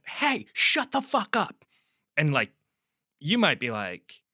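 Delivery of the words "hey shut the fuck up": louder, much higher, stretched-out mock shout; retold coach's reprimand. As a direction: imitate a coach's sharp, high-pitched yell. Retelling coach yelling at player.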